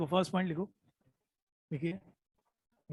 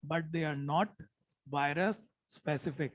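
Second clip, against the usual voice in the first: slow dictation; 2 semitones lower, 4 dB quieter, much slower than usual. Shown as a demonstration. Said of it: "मैं जानबूझ के धीरे बोल रहा हूँ".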